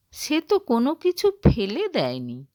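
plain reading voice

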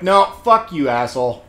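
loud indignant yell at the screen; mock-furious retort, breaking into a laugh; retold outburst. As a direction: shout back at the villain, half laughing. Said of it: "I'm like".

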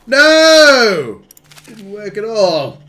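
high, loud, drawn-out no; dismayed shout. Real, not acted. Player whose plan failed, dismayed shout. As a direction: wail it loudly, then ease off still high.

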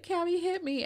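put-on high, calm little boy; high child's voice offering to take a hit; gentle boy voice. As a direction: pitch it up, patient and gentle. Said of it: "he just will sit there and be like".

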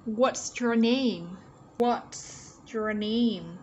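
very slow, drawn-out teacher's question; careful, stretched pronunciation model; read-along model. Shown as a demonstration. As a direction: say it slowly for learners to repeat. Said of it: "Các bạn cũng đọc theo cô nhé".

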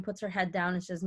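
normal talking voice

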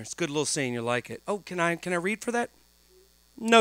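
ordinary talk, nothing marked

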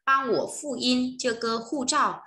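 teacher says the Chinese slowly for students; slow model pronunciation. Shown as a demonstration. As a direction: model each syllable clearly. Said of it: "sẽ nói là gì".

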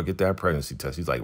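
usual speaking voice

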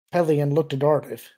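ordinary delivery, nothing marked

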